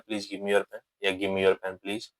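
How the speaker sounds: soft, slow polite asks; polite requests. Shown as a demonstration. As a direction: say each gently with good manners. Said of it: "हमेशा इस तरीके से बोलना है". (धीमे, नरम और विनम्र ढंग से)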